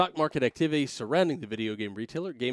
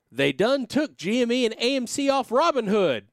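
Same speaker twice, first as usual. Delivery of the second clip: put-on Southern accent; 9 semitones higher, 6 dB louder than usual. Host reads a Reddit post in a hammed-up Southern accent. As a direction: ham up a thick Southern yokel. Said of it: "making these guys extremely Southern".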